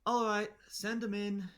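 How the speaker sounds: loud, high call to the guards; yell. Acted. Queen yells to the front guards to send them in, loud and high. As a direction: call it out across the hall. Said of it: "you yell to the front guards".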